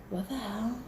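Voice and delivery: softly mouthed, puzzled aside; hushed disbelief. Retold or imitated. Puzzled at a strange hidden room, hushed disbelief. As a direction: say it under your breath, baffled. Acting out her puzzled reaction.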